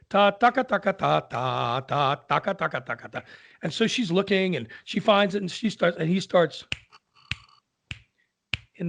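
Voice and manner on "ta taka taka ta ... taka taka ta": rattled-off "ta-taka" notes; rhythmic vocal mimicry, fading away; imitated piano rhythm. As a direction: beat out a piano figure by mouth.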